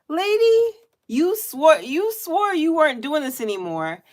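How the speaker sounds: high-pitched, incredulous protest; mock indignant scolding.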